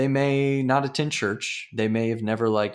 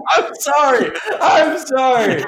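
high, loud flustered laughing apology; embarrassed; 9 dB louder than usual. Real, not acted. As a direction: giggle out the apology, red-faced. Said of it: "I'm embarrassed".

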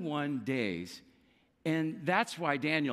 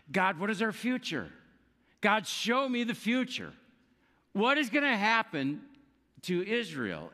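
pleading prayer; 6 semitones higher than usual. Retold or imitated. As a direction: beg God to show the future. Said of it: "Daniel is asking".

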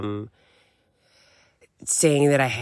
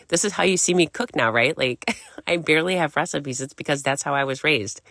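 ordinary talk, nothing marked

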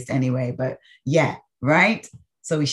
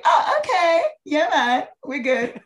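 relieved delight; 8 semitones higher than usual. Retold or imitated. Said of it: "and be like".

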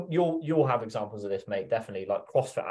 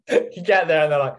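higher and louder, laughing through it; 9 semitones higher, 9 dB louder than usual. Real, not acted. Two mates laughing at the first day, laughing. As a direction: keep laughing as you talk. Laughing while sharing the story.

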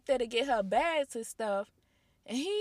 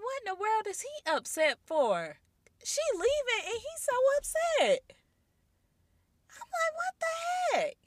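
shocked; 12 semitones higher than usual. Retold or imitated.

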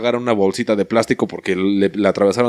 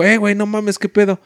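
friend's alarmed voice; 9 semitones higher than usual. Retold or imitated. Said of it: "luego llegó y dijo".

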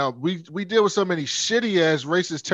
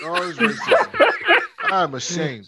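mock ashamed laugh; 3 semitones higher than usual.

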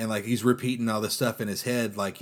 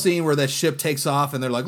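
ordinary talk, nothing marked

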